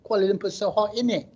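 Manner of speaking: mock a Malaysian friend putting on British airs; showy put-on London accent. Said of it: "They come back like".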